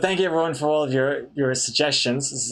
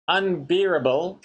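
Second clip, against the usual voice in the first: ordinary delivery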